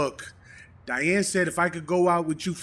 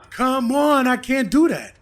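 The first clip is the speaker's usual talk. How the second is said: louder, higher whining protest; 6 semitones higher, 9 dB louder, slower than usual. Acted. Boyfriend begging not to be thrown out, loud whine. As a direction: plead loudly that you can't go.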